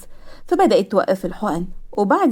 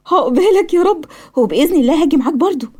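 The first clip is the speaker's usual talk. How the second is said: high, lifted happy wish; acted delight, voice raised in pitch; overjoyed; 6 semitones higher, 4 dB louder than usual. A narrator's reading. Nurse wishing her patient the same success, overjoyed. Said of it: "سهى فرحت جدًا".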